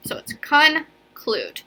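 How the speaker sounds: conclude spezzato in con e clude, lento; syllables split. Shown as a demonstration. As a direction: dire conclude staccando le due sillabe, più acuto. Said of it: "we are gonna break conclude between the N and the C".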